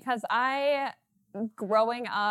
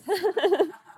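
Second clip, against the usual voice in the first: short laugh; 9 semitones higher, 8 dB louder than usual. Real, not acted. Laughs about tall beer.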